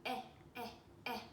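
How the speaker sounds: short repeated eh sound; isolated vowel sounds for kids. Shown as a demonstration. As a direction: say eh, eh, eh crisply, one by one. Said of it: "And the E".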